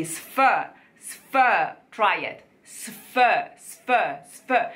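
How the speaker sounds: sf with the s held a little long to help; sf drill. Shown as a demonstration. Coach drilling sf for beginners. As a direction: drill the sf cluster. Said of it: "Don't stay on the S".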